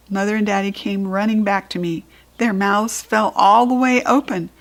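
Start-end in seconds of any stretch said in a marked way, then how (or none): none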